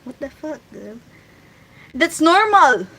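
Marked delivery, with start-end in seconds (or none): none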